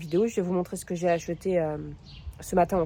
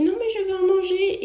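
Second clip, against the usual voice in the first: put-on child voice; 12 semitones higher than usual. Child Margot quoted by mother.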